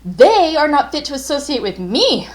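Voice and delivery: loud, high outburst of defiance; child's defiant shout from the banister; crying out. A narrator's reading. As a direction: fling it out suddenly at her. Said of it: "I cried out suddenly".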